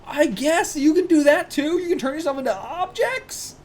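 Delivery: high, incredulous exclaiming; high-pitched excited disbelief; amazed outburst. Real, not acted. Amazed reaction to a manga power.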